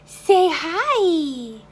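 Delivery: high, loud sing-song. Real, not acted. Prompting baby.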